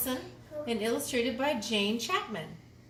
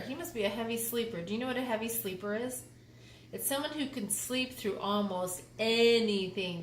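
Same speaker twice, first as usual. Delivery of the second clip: quick aside; faster than usual. Real, not acted. Quick quiet explanation.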